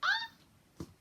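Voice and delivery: piercing high fangirl squeal; delighted squeal. Real, not acted. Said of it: "and I was like".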